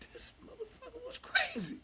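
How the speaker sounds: high, whining cry of disbelief; squealing disbelief. Retold or imitated. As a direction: squeal it like you can't believe your eyes. Reacting to his old footage.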